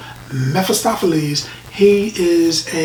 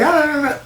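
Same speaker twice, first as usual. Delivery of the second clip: startled gasps; 11 semitones higher, faster than usual. Retold or imitated. People reacting to Mephisto's glance.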